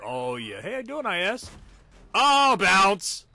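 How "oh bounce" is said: loud, high bounce call; excited call. Real, not acted. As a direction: exclaim as the shell bounces off. Reacting to a bounced shell.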